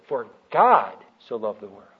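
GOD hit loud and drawn out; stress on GOD. Shown as a demonstration. Teacher showing how to meditate on a verse, stressing GOD. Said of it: "I like to emphasize different words".

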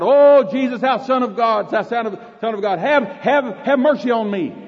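high, loud desperate plea; crying out. Acted. Old blind man begs Jesus for mercy, loud desperate cry. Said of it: "He cried out".

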